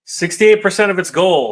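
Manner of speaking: real excitement at the fundraising total; thrilled announcement. Real, not acted. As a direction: blurt the number out in delight. Reading the funding total, thrilled.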